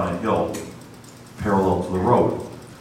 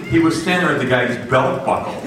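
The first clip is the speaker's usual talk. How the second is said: ordinary talk, nothing marked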